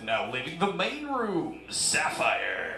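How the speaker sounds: slow announcer, dropping low on the name; mock club announcer voice; strip club DJ. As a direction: announce the dancer, drawing out her name.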